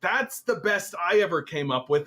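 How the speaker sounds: usual speaking voice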